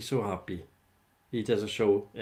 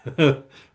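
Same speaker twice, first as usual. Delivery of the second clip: a burst of loud laughter; 4 semitones higher, 11 dB louder than usual. Real, not acted. Laughing at George's antics.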